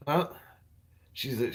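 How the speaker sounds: ordinary talk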